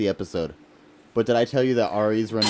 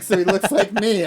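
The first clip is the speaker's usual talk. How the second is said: high, laughing recap; 9 semitones higher than usual. Real, not acted. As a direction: tell it while cracking up. Retelling own confusion, cracking up.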